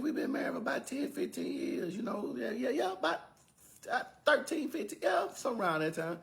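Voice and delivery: high, hesitant mock voice of the husband; hammed-up high voice fumbling the years; unsure husband. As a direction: squeak out the numbers like he can't remember. Joe unsure how long married.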